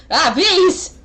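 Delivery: high, loud imitation of a panicked yell; mock distant scream. Game master imitates Eamon echoing through the hallways, mock scream. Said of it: "you just hear it echoing through the hallways".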